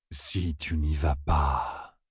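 low, soft, slow pronouncement; solemn oracle man. A narrator's reading. Oracle man interrupting Matt, slow solemn voice. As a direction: cut in with slow, low gravity.

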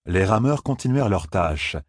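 ordinary delivery, nothing marked

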